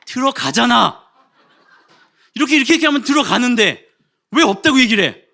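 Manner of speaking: high, loud, laughing indignation; comic fury. Retold or imitated. Husband fuming at his wife over the freezer, mock furious. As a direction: rant in mock outrage, half laughing. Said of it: "다시 화가 나기 시작했어요".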